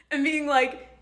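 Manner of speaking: usual speaking voice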